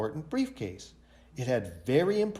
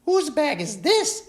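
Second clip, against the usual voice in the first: much higher, louder teacher voice; teacher asking; 13 semitones higher, 5 dB louder than usual. A narrator's reading. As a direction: ask the class sternly. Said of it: "asked the teacher".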